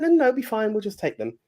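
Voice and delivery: high, eager politeness; overly polite young man. Retold or imitated. Polite intruder declining, pitched high and eager. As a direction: brush it off with a high, chirpy no no no. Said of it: "The guy's like".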